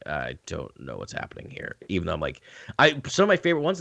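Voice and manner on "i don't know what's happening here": low, soft baffled aside; retold confusion, dropped low and quiet; mock bafflement. Listener recalling the audiobook, low baffled muttering. Acts out own baffled reaction.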